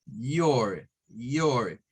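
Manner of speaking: low, drawn-out "Your" rhyming with "or"; "or" sound. Shown as a demonstration. Teacher models the American variant, "Your" with a full or sound. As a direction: say "Your" slowly with an open o plus r. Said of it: "otra más como un or".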